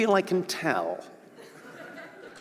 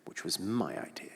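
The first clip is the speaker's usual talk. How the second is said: soft unvoiced whisper, lower; whispered aside; 9 semitones lower, 15 dB quieter than usual. Real, not acted. Comedian slipping a petty claim to the crowd, whispered aside. Muttered aside about podcast.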